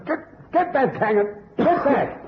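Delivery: high, urgent shouted warning. Acted. Inspector warns Cannon off the gas, urgent shout. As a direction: push him back from danger.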